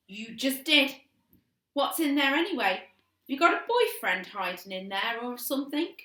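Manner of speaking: high, loud, teasing sister's voice; taunting little sister. A narrator's reading. Annabel taunts her sister.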